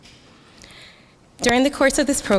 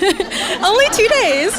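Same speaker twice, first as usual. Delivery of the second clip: higher, slow, words squeezed out through real laughter; laughing; 12 semitones higher, 7 dB louder, much slower than usual. Real, not acted. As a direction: laugh helplessly while repeating it. Laughs repeating her own words.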